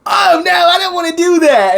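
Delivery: high, drawn-out whiny protest; mock reluctant whine. Retold reluctant girl refusing.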